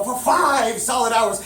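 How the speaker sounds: ordinary delivery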